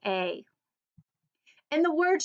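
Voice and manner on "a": "A" said as a clear long ay; long A sound. Shown as a demonstration. Teacher showing what sneaky E makes "A" say. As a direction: model "A" as ay. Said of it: "making the A say".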